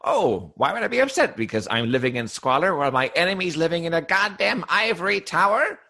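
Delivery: high, theatrical villain's indignation; ranting Monarch. Acted. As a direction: rant in an over-the-top, aggrieved villain voice. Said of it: "of course, the Monarch is".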